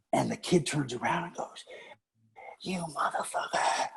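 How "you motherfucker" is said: hissed whisper of "You motherfucker", no voice at all; whispered curse. Retold or imitated. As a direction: breathe the insult at him under your breath. Kid turns, curses teacher.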